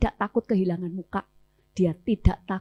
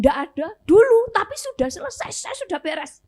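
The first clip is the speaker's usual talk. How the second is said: mock breezy denial; 9 semitones higher, 7 dB louder than usual. Woman's breezy denial, retold.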